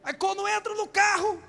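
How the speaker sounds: ordinary delivery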